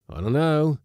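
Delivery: high, loud nervous answer; a frightened sailor blurting it out; shaky. A narrator's reading. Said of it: "at the wheel shakily".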